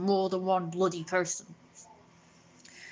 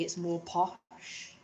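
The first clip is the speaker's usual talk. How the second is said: mock posh voice; 2 semitones lower, 6 dB quieter, much slower than usual.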